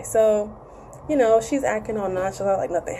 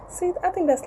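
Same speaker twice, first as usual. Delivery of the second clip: ordinary talk, nothing marked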